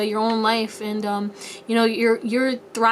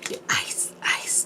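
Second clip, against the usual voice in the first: soft whispered chant; playful whisper; 7 dB quieter, slower than usual. Pretend. Reader riffing on being ice cold, playfully whispering. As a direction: whisper it like a song hook.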